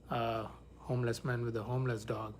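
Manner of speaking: normal talking voice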